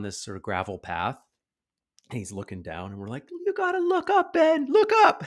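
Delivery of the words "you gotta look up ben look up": very high, loud urgent coaching; high-pitched acted holler; calling out warning. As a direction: re-enact shouting encouragement to a kid. Calling to wobbling child.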